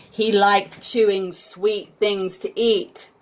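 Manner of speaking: ordinary delivery, nothing marked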